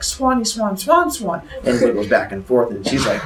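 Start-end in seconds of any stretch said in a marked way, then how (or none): 0.0-1.4 high-pitched cartoon swan; silly swan voice. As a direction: chant it high, playing a swan. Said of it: "And he's like".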